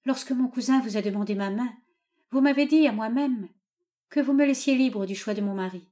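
lightly voiced reproachful daughter; mildly pleading protest; unhappy daughter. A narrator's reading.

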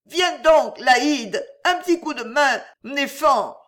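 put-on high, loud drunken-style plea up the stairs; wailing call for a hand; calling for help. A narrator's reading. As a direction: call out high and drawn to a friend. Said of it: "mêlés d'appels".

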